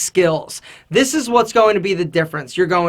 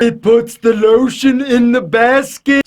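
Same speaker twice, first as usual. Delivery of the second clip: creepy deep voice; 2 semitones higher, 4 dB louder than usual. Retold or imitated. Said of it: "sound super weird".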